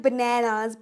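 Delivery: "bananas" with "nae" in the middle; affected wrong bananas. Retold or imitated. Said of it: "लोग मुंह बना के बोलते हैं". ("bananas" को "बनैनाज़" जैसा बोलें)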